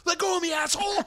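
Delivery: high mock yell; panicked cameraman. Cameraman fighting off a clinging skydiver, mock yell. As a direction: act out an alarmed, high-pitched protest.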